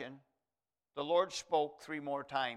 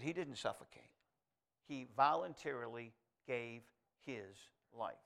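soft, kind, polite correction, much softer; gentle correction; 5 semitones lower, 7 dB quieter than usual. Retold or imitated. Senior pastor correcting the preacher boy, softly and kindly. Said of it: "He said it very kindly, very gently".

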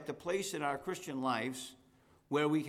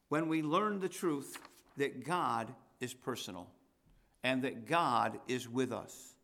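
ordinary talk, nothing marked

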